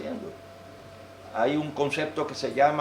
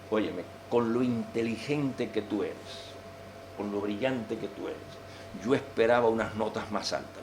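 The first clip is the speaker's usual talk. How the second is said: soft, lower encouraging parent voice; gentle encouragement; 5 semitones lower, 6 dB quieter than usual. Shown as a demonstration. Parent gently encourages child about grades, soft and low. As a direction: coax him kindly, softer and lower. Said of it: "la táctica que algunos psicólogos recomiendan".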